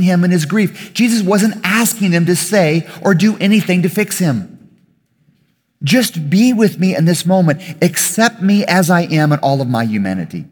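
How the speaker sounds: loud, high, impassioned pulpit delivery; fervent sermon voice, pushing every line; preaching at full force. Acted. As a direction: preach it to the back row. Preaches on Jesus and disciples.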